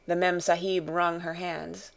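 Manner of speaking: plain reading voice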